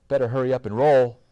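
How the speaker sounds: fast, loud urging; urgent. A narrator's reading. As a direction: hurry him along urgently. Urging brother with robot near.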